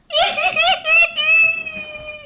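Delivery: very high squealed cackle; shrieking laugh. Real, not acted. Host hyped for the squad arriving, squealing laugh.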